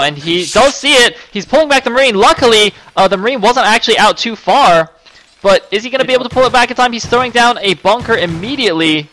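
rising, loud live commentary; excited play-by-play. Real, not acted. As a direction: call the action as it happens.

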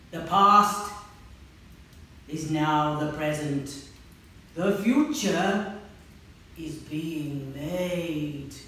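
slow, loud, rumbling pronouncement; rumbling dragon. Acted. Dragon's voice speaking to the children, slow thunderous rumble. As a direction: let it roll like thunder. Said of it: "rumbled through the night sky like thunder".

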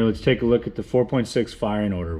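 usual speaking voice

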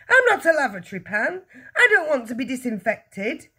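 high, loud indignant protest; crying out. A narrator's reading. Husband objects to being disinfected, crying out. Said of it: "the husband cried".

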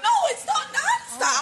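high, drawn-out plaintive objection; upset protest. Real, not acted. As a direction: insist slowly, hurt and high.

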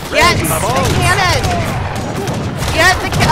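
loud, high, punched-out commands; yelling at the game. Real, not acted. Player commanding units to get the cannon, yelling. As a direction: yell each order in short bursts. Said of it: "it would hear me yelling at the game".

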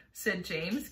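plain reading voice

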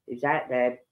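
usual speaking voice

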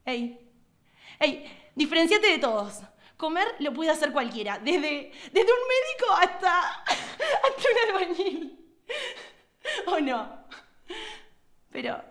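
high, loud virtual guru, breaking into laughter; Lágrima. Acted. Alter ego guru urging followers to be different, high giddy preaching. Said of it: "Lágrima era un gurú virtual que pregonaba".

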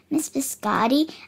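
ordinary delivery

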